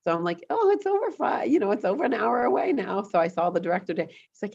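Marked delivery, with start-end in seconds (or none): 0.5-3.0 high, exaggerated dismay; hammed-up griping; mock whiny complaint. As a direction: play up the fuss of the long drive. Retold own mock complaint.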